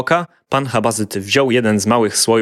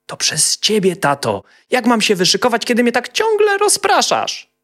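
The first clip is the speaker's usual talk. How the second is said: put-on much higher childish voice, rising in protest; cheeky little boy; 14 semitones higher, 3 dB louder than usual. A narrator's reading. Little boy blaming his father for distracting him. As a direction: blame dad like an indignant kid.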